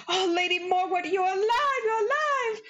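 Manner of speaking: high, rising cry of relief; overjoyed to find her alive; relieved joy. Acted. Prince finds her alive.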